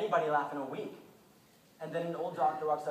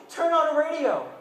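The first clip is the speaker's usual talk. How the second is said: urgent shout; 11 semitones higher, 13 dB louder than usual. Retold or imitated. Man bursts into prayer meeting.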